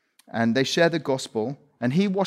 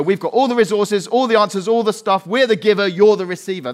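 mock proud westerner; 5 semitones higher, 6 dB louder than usual. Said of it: "we can turn up and go".

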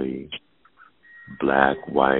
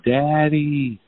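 high, tearful little call of Daddy; imitating daughter crying; 11 semitones higher than usual. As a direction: mimic a crying girl calling her dad. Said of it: "she's crying".

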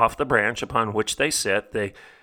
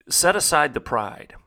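usual speaking voice

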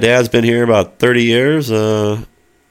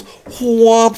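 flinging whomp; 3 dB louder than usual. Retold or imitated. Imitates the food being flung.

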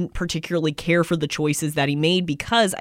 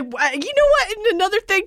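incredulous outburst; 13 semitones higher than usual. Real, not acted. Rants to co-host about the fridge, rising high in disbelief. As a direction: pitch jumps way up in disbelief.

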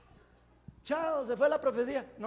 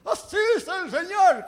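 much louder, higher showy prophet voice; special prophecy tone; 7 semitones higher, 13 dB louder than usual. Retold or imitated. Preacher mocking a theatrical prophecy voice, loud and grand. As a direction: boom it out like a stage prophet. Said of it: "con un tono especial para que la profecía pegue".

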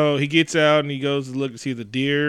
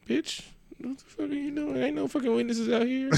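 high, quiet, menacing cop; 11 semitones higher, 10 dB quieter than usual. Retold or imitated. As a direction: threaten like a crooked cop. Cop voiced in the retelling.

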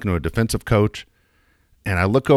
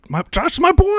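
high proud dad; 4 dB louder than usual. Retold or imitated. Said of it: "and I said".

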